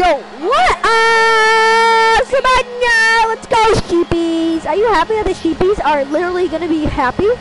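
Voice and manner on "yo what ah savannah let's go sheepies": excited cheer. Real, not acted. Celebrating a goal.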